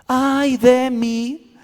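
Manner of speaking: más alto, muy lento y estirado; lamento de Isaías. Acted. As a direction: gime con terror. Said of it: "si la cantáramos como Isaías". (Isaías se lamenta aterrado)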